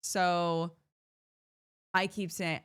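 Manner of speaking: ordinary talk, nothing marked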